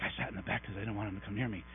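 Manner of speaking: back-row listener. Retold or imitated. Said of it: "They're like".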